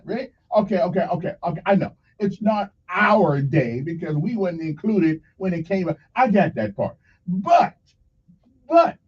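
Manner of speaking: normal talking voice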